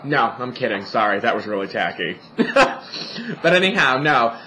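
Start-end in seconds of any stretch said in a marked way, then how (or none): none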